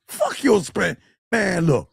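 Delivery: ordinary talk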